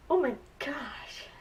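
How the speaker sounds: slow, breathless whispered gasp; shocked whisper. Retold or imitated. Retelling her shock at a death in the show, whispered. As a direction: whisper it like you can't believe what you saw. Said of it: "I was like".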